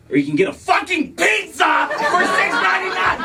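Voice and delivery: high, strained comic outburst; hammed-up furious husband shout; exasperated shout. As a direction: explode in disbelief, yelling it. Husband explodes in acted argument.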